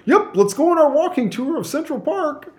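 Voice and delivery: high-pitched, chirpy cheerfulness; bubbly. Retold or imitated. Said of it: "as bubbly as I always am".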